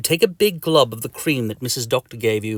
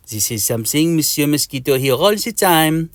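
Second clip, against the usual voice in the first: put-on higher French-accented mosquito; 5 semitones higher, 3 dB louder than usual. Mosquito brushes off his friend's warning, French accent.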